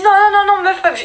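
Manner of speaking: high, urgent no no no; frightened insisting. Retold or imitated. Scared girl insisting to her sister, high urgent.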